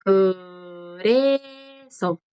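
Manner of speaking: "Por eso" very slow, syllables held long; drawn-out teacher's model of "Por eso"; slow pronunciation demo. Shown as a demonstration. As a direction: stretch each vowel of "Por eso" for learners. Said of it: "Esta es la forma de decir".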